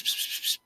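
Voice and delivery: hushed hissed "psst, psst, psst"; voiceless little hisses. Retold or imitated. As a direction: imitate someone furtively trying to get attention. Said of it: "a little pss".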